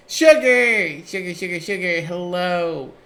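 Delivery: high, loud excited calling out; excited greeting. Real, not acted. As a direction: greet her like a long-lost friend.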